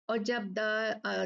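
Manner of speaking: ordinary delivery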